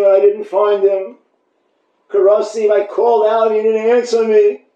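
high, loud searching chant. Acted. Rabbi chanting the bride's search through Jerusalem, high and pleading. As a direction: cry out the verse as she searches.

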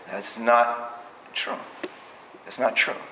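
low, quiet, firm contradiction; quietly unyielding correction; firm rebuttal. Acted. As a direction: drop low and soft to refute the excuse. Acted stern rebuttal to the excuse.

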